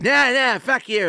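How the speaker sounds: high, loud mock snarl; mad sore loser. Friend losing at ping pong, angry mock snarl. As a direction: spit it out like a sulking loser. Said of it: "he would make it more mad".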